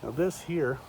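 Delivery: usual speaking voice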